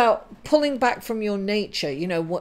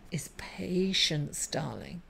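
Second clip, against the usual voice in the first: breathy whisper, slow and hushed; soft whisper; 3 semitones lower, 8 dB quieter, slower than usual. Real, not acted. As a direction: lean in and whisper it like a secret. Leans in on the key message.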